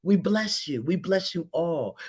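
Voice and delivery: ordinary talk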